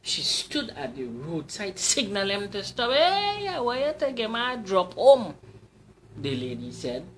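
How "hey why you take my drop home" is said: high, loud calling lady's voice. Acted. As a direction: call out to the passing cyclist, sweet and high. Lady flags down cyclist.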